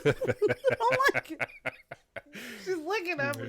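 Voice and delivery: high, loud laughing complaint; laughing protest. Real, not acted. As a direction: laugh while pushing the dog off. Dog licking her, laughing.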